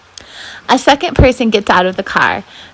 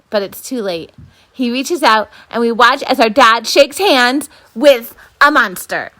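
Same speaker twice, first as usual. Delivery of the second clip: plain reading voice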